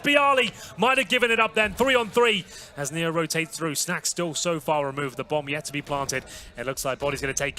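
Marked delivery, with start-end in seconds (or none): 0.0-2.4 excited shout. Real, not acted. Caster shouts at the shot.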